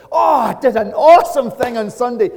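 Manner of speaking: high, loud, hammed-up excitement; gushing enthusiasm. Churchgoer telling others all week about Sunday, hammed-up gushing. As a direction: gush it out in mock weekday chat. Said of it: "you'll be talking like this".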